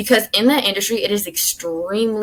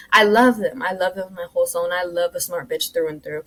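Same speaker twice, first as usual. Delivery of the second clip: ordinary talk, nothing marked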